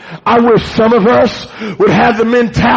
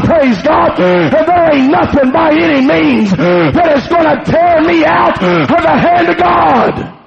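high, shouted pulpit climax; preaching at full force; 5 semitones higher than usual. Acted. Preacher vowing to hold on to God, full-force shout. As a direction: shout your stand before God.

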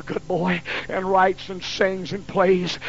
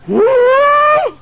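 whoop; 11 dB louder than usual. Real, not acted. Recalling the whipping.

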